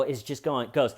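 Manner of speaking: normal talking voice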